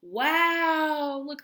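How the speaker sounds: loud, high wow of wonder; a child's amazed wow. Acted. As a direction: gasp it out in awe. Character marvels at the tusk.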